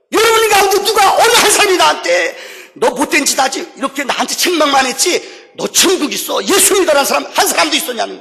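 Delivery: high screamed accusation, easing into loud reproach; retold screaming. Hitler accusing the believers at judgment, acted high scream. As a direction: act out a screamer's outburst, then ease slightly. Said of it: "괴성을 지르면서".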